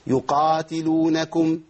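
يقاتلونكم slowly with strong wide-open qaf; teacher models the open qaf. Shown as a demonstration. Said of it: "القاف المنفتحة قوي".

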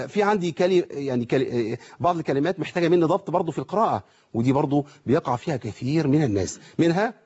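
ordinary delivery

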